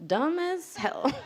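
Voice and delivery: slow, drawn-out deadpan put-down; slow comic verdict with a laugh; mock dismissive judgment. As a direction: stretch it out in mock disdain. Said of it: "speaking in my American English accent".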